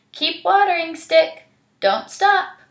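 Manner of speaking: high, loud command; bossy Anansi. Acted. Anansi commanding the magic stick, high bossy call. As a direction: order the stick about.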